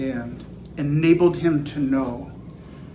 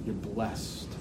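low, very soft pronouncement; Jesus's quiet blessing; 5 semitones lower, 10 dB quieter than usual. Acted. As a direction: lower the voice almost to a murmur. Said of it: "But he said something else".

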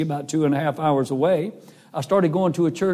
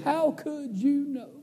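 put-on doubting teen; 10 semitones higher, 6 dB quieter, slower than usual. Crying boy doubts him.

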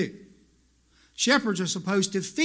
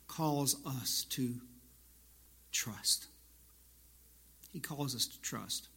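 hushed; 6 semitones lower, 12 dB quieter than usual. Real, not acted. Preacher drops to a hush.

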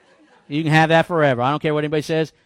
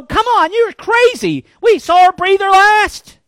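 mocking mourners; 10 dB louder, slower than usual. Mourners mocking Jesus at the house.

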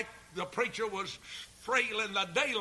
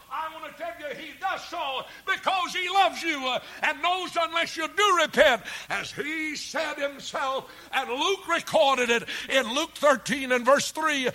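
higher, louder pleading sermon; preaching at full force; 7 semitones higher, 7 dB louder than usual. Acted. Preacher warning sinners to repent before they perish, booming.

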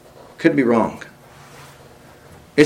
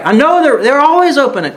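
high, louder mock insistence; stubborn insistence; 11 semitones higher, 4 dB louder than usual. Stubborn diner insisting the place is open, mock emphatic. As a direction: play the know-it-all who won't listen.

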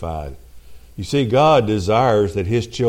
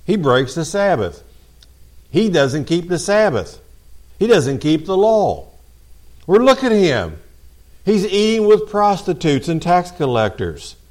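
higher, accusing complaint against Jesus; mock-outraged Pharisees complaining about Jesus; indignant Pharisee grumbling; 8 semitones higher than usual. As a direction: sound scandalised, pointing a finger.

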